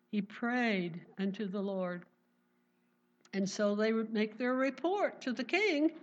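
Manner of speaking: ordinary talk, nothing marked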